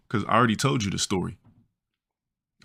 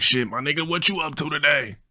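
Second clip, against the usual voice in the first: friend's voice; 7 semitones higher than usual. Retold or imitated. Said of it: "Yeah, that's how he talked".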